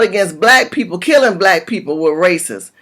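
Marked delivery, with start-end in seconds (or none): none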